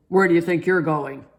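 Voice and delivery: a cop stopping a speeding car; lightly voiced stern officer; gruff officer. A narrator's reading. Police officer stops Daddy.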